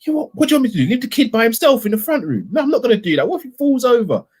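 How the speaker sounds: high-pitched mock argument with a partner; imitated domestic row. Cal arguing over leaving the kid alone, high mock protest. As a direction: act out protesting at home, voice pitched right up. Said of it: "And he's like".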